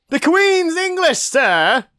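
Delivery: high, loud, slow posh salute; posh British voice. Acted. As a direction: proclaim it like a pompous Brit. Mock posh proclamation.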